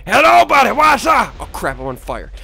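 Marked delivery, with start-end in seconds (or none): 0.1-1.3 high, loud taunting hail; gleeful shouted greeting. Real, not acted. Gamer jumping out on an enemy, gleeful shout. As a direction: holler at your prey.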